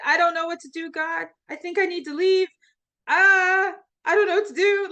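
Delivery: desperate plea. Retold or imitated. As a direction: beg God for an answer, at a loss. Retelling desperate journal prayer.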